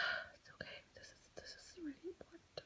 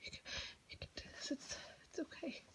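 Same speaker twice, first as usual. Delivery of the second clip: hushed, anxious whisper to herself; 10 dB louder than usual. Acted. Student at her desk whispering pep talk to herself, anxious whisper. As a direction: keep it breathy and secret, talking yourself through it.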